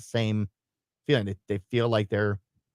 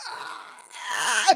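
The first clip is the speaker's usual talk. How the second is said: high, barely audible screeching "aah"; strained screech; 9 semitones higher, 3 dB quieter than usual. Retold or imitated. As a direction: try to scream with almost nothing coming out. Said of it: "almost barely audible screeching sound".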